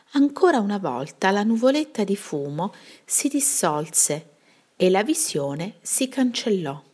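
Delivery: ordinary delivery, nothing marked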